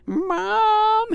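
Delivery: high, loud call to another room; shouting for Mom. Acted. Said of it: "he shouted".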